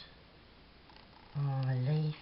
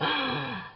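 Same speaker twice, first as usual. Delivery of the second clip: gasp; 7 semitones higher than usual. Retold or imitated. Retold near miss.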